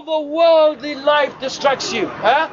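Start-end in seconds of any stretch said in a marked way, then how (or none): none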